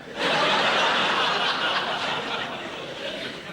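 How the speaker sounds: loud guilty laugh; forced laugh. Acted. Husband caught out about the lipstick, forced loud laugh. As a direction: laugh too hard to cover it up.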